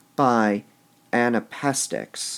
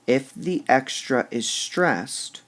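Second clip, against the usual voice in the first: ordinary delivery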